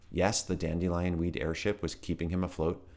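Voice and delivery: plain reading voice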